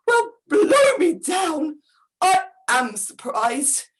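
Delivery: plain reading voice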